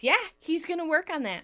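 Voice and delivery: high, quick cut-in; acted hasty interruption; interrupting. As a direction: jump in over someone brightly. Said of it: "and Monica interrupts".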